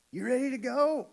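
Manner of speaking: yelling out to see if his son is ready; dad calling from the truck; retold yell. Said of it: "I heard him yell".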